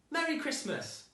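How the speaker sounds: put-on a boy glad his family is back; joyful boy. A narrator's reading. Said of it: "said Dan to his whole family".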